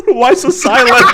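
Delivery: laughing out loud, high. Real, not acted. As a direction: tease him through laughter. Laughing teasing a quiet cohost.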